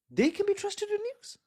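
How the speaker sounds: high, mocking sing-song. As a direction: deliver it as a biting joke. Sarcastic refrain.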